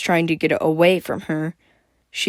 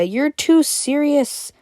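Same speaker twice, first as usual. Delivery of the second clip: teasing; 9 semitones higher, slower than usual. Acted. Playful teasing.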